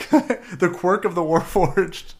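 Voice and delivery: laughing in disbelief; high-pitched laughter through the words. Real, not acted. Laughing at the dice roll.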